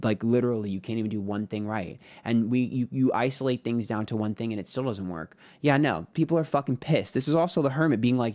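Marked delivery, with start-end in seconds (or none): 5.6-8.3 aggressive. Real, not acted. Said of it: "so aggressive and maybe, like, accusatory in tone".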